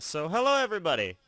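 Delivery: bright, high, loud radio-host welcome; podcast host intro. Pretend. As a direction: greet listeners like a cheesy show host. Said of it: "Such a smooth intro there".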